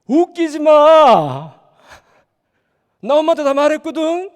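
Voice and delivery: loud, slow, drawn-out comeback; defiant shout. Acted. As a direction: shout it back at the bossy sister. 동생이 누나에게 맞섬. (누나에게 맞서 크게 외치며 느리게 쏘아붙이는 말투)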